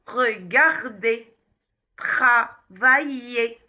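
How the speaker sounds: "regardez, travailler" with R from the throat, slowly; guttural R. Shown as a demonstration. Teacher repeats the sound, "regardez, travailler" with guttural R. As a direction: stretch "regardez, travailler", each R made at the back. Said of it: "à l'arrière de la gorge".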